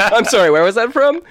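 ordinary delivery

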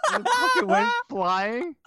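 high, loud burst of excitement; excited. Real, not acted. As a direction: blurt it out thrilled. Telling wild kitchen story.

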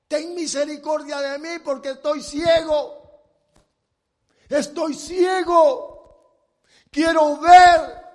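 blind man crying out loud, slowing at the end; desperate shouted plea. Retold or imitated. Preacher voicing the blind beggar begging Jesus, loud desperate cry. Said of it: "Déjeme agregarle un poquito".

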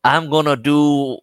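hammed-up movie accent, loud and slow; mock the accent, high and drawn out; exaggerated American accent impression, stretched vowels; Tom Cruise. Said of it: "accent in this".

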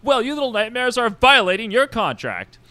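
high-pitched, indignant boss; scolding tyrant director. Acted. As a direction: scold them in a high showman's voice. Mojo scolds his cast.

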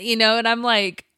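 usual speaking voice

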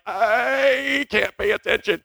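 slow, drawn-out mock inattention; put-on distractible student; mock distracted. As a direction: stretch it out as if drifting off. Mocks himself too distracted to learn.

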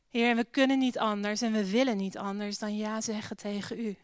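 usual speaking voice

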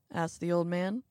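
ordinary delivery, nothing marked

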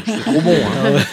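chuckling through a bite of food; laughing with mouth full. Real, not acted. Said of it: "pardon, j'ai la bouche pleine".

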